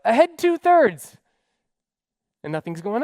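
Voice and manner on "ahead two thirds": loud, high, slow shouted repeat; navigator's yell. Retold or imitated. Navigator shouting the order back to the captain. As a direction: echo the captain's order at full volume. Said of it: "The navigator yells out".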